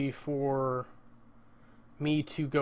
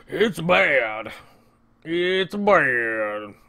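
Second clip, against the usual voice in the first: mock presenting a draft; 9 dB louder, much slower than usual. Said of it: "just being like".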